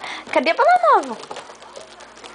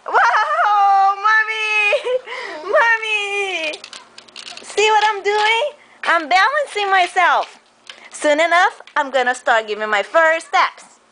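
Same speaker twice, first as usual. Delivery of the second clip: voicing the baby; 6 semitones higher, slower than usual.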